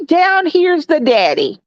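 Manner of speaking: usual speaking voice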